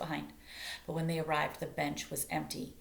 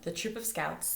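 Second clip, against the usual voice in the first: ordinary delivery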